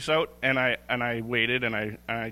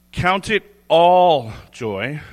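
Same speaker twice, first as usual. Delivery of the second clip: 1 scripture reading; 4 semitones higher, 10 dB louder, much slower than usual. Acted.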